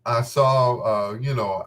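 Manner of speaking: normal talking voice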